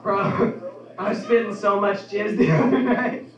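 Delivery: can't get through the line without laughing; laughing bro voice. Retold or imitated. Bro quote broken by laughter.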